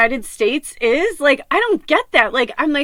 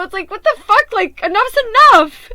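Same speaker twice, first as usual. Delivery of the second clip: higher and louder, fed up; exasperated outburst; 7 semitones higher, 5 dB louder than usual. Real, not acted. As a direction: throw up your hands, enough's enough. Exasperated by mounting grief.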